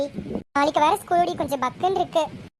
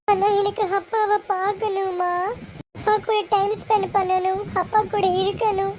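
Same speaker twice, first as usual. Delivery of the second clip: slow, drawn-out weepy plea; crying child longing for a parent; tearful pleading; 3 semitones higher than usual. Acted. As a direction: plead tearfully, stretching out each phrase. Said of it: "ஏண்டி அழுகுற".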